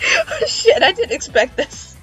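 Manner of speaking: ordinary talk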